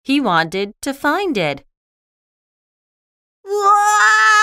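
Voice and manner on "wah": high, loud, sustained wail; long wailing cry. Acted. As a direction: wail out in dismay. Schroeder cries out over piano.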